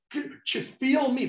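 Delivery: put-on high, odd voice for a water polo kid; lightly voiced water polo kid; intense kid. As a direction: urge them to feel you.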